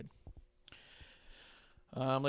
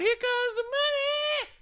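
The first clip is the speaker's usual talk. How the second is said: "comes" sung out high and stretched; stress on comes; slower than usual. Retold or imitated. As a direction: hit "comes" hard and high, slowly. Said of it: "Emphasis on the comes".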